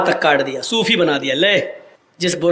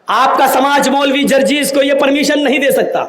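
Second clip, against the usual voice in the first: normal talking voice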